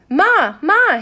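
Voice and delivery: loud, high shout for his mother; boy running in, shouting for his mother. A narrator's reading. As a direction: burst in yelling for Ma. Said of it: "he shouted".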